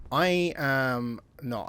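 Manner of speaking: ordinary talk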